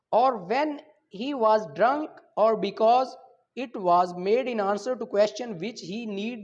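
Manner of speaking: slow, loud, deliberate reading of legal text; statute reading. Acted. Lecturer reads the law aloud to his students, slow and emphatic. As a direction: read each clause out slowly, as if dictating.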